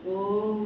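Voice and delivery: ओम held for two beats, low; low, medium-long ओम; two-beat length. Shown as a demonstration. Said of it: "दो की गति क्या हुई?".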